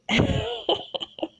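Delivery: a short high giggle of joy. Real, not acted.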